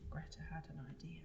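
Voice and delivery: fast breathy whisper of a secret plan; quick conspiratorial whisper; whispered idea. Shown as a demonstration. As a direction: hush it like an exciting secret. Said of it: "we do this for then".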